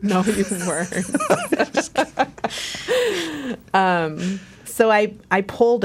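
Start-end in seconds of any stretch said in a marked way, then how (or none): 1.0-3.7 long, high burst of laughter; real laughter. Real, not acted. Sister laughing off brother's silly imitation, real amused laughter. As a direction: laugh helplessly at the joke.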